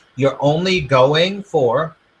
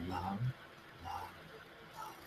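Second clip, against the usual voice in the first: soft mantra; 5 semitones lower, 26 dB quieter than usual. Shown as a demonstration. Coach demonstrating a calm love mantra, hushed. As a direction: breathe the word out gently, eyes closed. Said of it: "Close your eyes and say".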